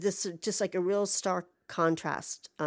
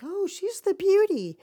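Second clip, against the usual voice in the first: high, loud gushing reveal; breathless high mock delight; mock movie gush; 12 semitones higher, 6 dB louder than usual. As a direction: swoon like a cheesy makeover scene.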